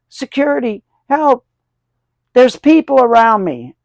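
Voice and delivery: high, loud mock panic calling for security; panicked celebrity. Shatner mocked as he cries out to security, high panicked yell. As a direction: ham up a frightened star yelling for help. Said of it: "He's like".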